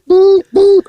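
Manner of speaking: high, loud beep beep; imitated beeping. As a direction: mimic a reversing horn.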